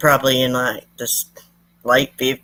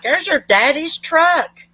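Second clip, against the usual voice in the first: startled exclaim; 12 semitones higher, slower than usual. Retold or imitated. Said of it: "I'm like".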